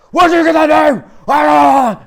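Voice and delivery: hammed-up, high-pitched furious scream; Batman yelling. Batman screaming at Superman for saying Martha, yelling. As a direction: scream it in exaggerated rage. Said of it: "starts yelling at him".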